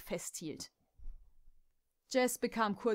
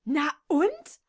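loud, higher, defiant snap; angry retort; 7 semitones higher, 10 dB louder than usual. Acted. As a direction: throw it back in her face. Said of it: "Jess war so wütend". (Jess faucht Kimberly trotzig und laut an)

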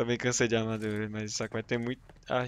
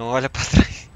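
scared horror game streamer; 2 semitones higher, 11 dB louder than usual. Real, not acted. Scared repeating warning.

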